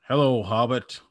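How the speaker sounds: lightly voiced wizard, ominous hello; Gandalf greeting. Gandalf at the hobbit's door, lightly voiced ominous greeting. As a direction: give it a hint of a looming wizard. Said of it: "And he goes".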